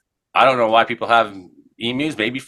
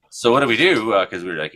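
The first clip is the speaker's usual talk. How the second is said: ordinary talk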